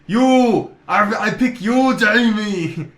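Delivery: hammy Austrian superhero proclamation; booming Turbo Man. Acted. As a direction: announce slowly as if over big speakers. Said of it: "on the mega speakers".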